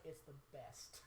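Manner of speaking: low, soft, understated retold reply; hushed imitation of a sincere tip; deadpan endorsement. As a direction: say it low and quiet, matter-of-fact. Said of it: "He's like".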